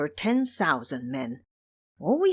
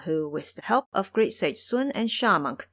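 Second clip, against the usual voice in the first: ordinary delivery, nothing marked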